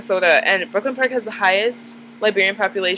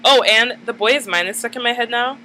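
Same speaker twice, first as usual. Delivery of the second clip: ordinary talk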